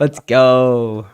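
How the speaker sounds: slow, very loud stretched celebration; excited cheer. Real, not acted. Cohost celebrates the draft working out, loud and stretched. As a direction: belt it out in triumph.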